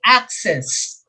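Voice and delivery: AC stressed in "access", falling on the second syllable; first-syllable stress. Shown as a demonstration. Teacher sounding out option B, "access" stressed on first syllable. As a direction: say "access" as AC-cess. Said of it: "First syllable".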